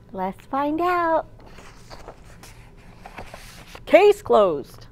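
lively picture-book storytime voice; reading to children. A narrator's reading. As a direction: read it aloud to a room of little kids.

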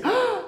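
high, sharp gasp of wonder; hammed-up storyteller gasp; amazed gasp. Shown as a demonstration. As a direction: gasp as if astonished for a child. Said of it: "como si fuera la cosa más espectacular del mundo".

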